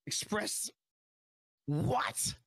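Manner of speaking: strained hoarse lost voice. Real, not acted. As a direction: voice giving out. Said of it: "I lost my voice".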